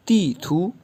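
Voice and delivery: तीथू with ती falling and थू rising; falling then rising tones. Shown as a demonstration. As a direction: drop the first syllable, lift the last on तीथू. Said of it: "तो यूँ pronounce होगा".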